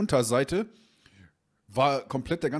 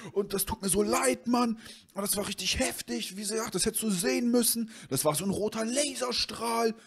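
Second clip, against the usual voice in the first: insisting friend; 11 semitones higher than usual. Retold or imitated. Friend defending his story to the owner, high and pleading.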